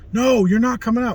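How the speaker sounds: high and loud, firm refusal; stern coach refusal. Retold or imitated. Coach telling his blind player he stays in, stern and loud. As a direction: lay down the law to the player.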